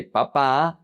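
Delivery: Papa stressed pa-PA; final-syllable stress. Shown as a demonstration. Teacher showing how potato becomes dad, Papa stressed last. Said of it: "change the emphasis and you say".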